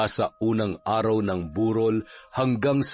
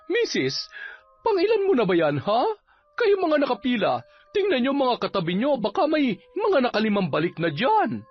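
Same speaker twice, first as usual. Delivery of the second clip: high, singled-out scolding; officious captain; 12 semitones higher, faster than usual. Acted. Captain questioning people in the queue, officious. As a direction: check the queue in a high pointed voice.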